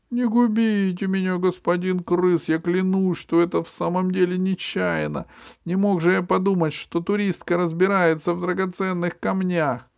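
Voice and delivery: very high, desperate begging; pleading squeak. A narrator's reading. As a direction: beg for mercy. M begs the rat.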